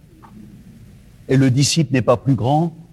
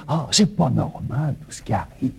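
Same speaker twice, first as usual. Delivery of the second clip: grumbling complainer; 3 semitones lower, 7 dB quieter than usual. Retold or imitated. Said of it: "mais on entend dire".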